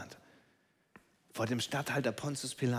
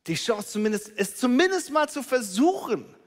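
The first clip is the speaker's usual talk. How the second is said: much higher and louder, exasperated; rising frustration; 9 semitones higher, 5 dB louder than usual. Acted. Disappointed crowd complains Jesus did nothing, higher and higher. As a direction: climb up in exasperation.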